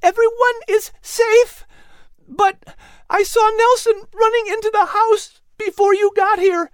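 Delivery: very high, loud, alarmed cry; urgent high-pitched call; shouting over din. A narrator's reading. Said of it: "she shouted above the din".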